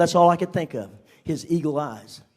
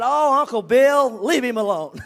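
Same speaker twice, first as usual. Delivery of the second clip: tired of this; 5 semitones higher, 8 dB louder than usual. Retold or imitated. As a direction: roll your eyes at uncle. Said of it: "Charlie's getting kinda tired".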